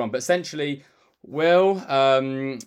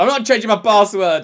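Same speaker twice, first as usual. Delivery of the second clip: loud, higher mock refusal; imitated indignant dad; 7 semitones higher, 8 dB louder than usual. Dad refusing the shop clerk, imitated loud indignation. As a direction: play a stubborn father digging in, raised voice. Acting out his stubborn dad.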